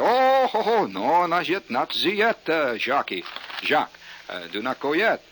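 high, loud French call; mock French accent. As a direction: call him back in fake French. Calling back the French waiter.

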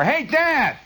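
high-pitched holler down the stairs. Shown as a demonstration. Kid at the head of the stairs, hollering. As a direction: call out for Dad's attention. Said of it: "go to the head of the stairs and holler".

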